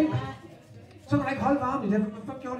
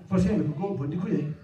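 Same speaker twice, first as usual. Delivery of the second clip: ordinary delivery, nothing marked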